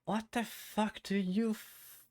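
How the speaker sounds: usual speaking voice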